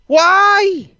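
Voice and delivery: high, loud drawn-out yell; yelled why. Acted. Complaining about the wait till May, exaggerated yell. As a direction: cry it out high and indignant. Mock outrage, yelled question.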